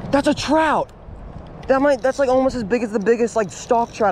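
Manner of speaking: excited. Real, not acted. Sizing up the fish.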